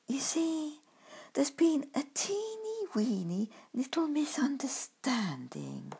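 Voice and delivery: soft whisper into an ear; confidential. Acted. Badger confides into Grumpkin's ear, soft sheepish whisper. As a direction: hush it, sheepish secret. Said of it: "to speak confidentially into my".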